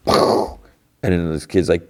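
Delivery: ordinary talk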